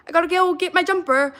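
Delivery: quoting a Netflix show line in a hammed-up British accent; mock British accent. Imitating a line from a British show, mock British accent. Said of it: "'cause I heard that in the show".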